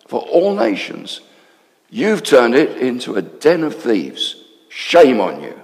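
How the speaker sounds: loud, slow, angry temple rebuke; shouting. Acted. Jesus shouting at the money changers, loud and slow. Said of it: "he started shouting".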